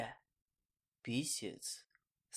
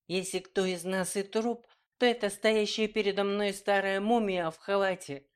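higher and louder, spiteful yell; angry outcry; 7 semitones higher, 4 dB louder than usual. A narrator's reading. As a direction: spit the insult out in rage. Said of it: "Зло выкрикнула эльфийка".

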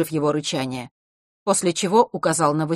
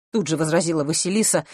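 usual speaking voice

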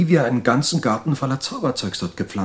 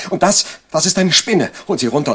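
angry insisting; 6 semitones higher, 4 dB louder than usual. Acted. George insists, raising his voice.

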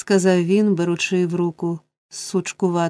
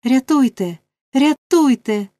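louder with each cry for help; 6 semitones higher, 4 dB louder than usual. A narrator's reading. Oliver runs from the thieves yelling for help, rising shout. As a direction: shout for rescue as you flee the room. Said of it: "кричачи".

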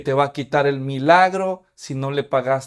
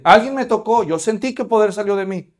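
insistent; 5 semitones higher, 5 dB louder than usual. Acted. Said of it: "Jesús sabía".

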